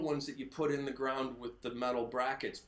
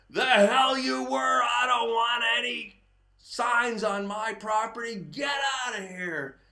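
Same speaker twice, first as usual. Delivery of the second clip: louder, higher furious yelling; mock-furious homeowner yelling from the porch; angry homeowner shout; 7 semitones higher, 7 dB louder than usual. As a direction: bellow them off the property. Homeowner yelling at sign crew.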